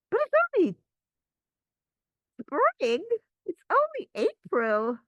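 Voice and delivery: high, loud, whiny body protesting; a squeaky body complaining about spring. Pretend. The body objects to ice cream, high squeaky protest. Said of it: "the body was like".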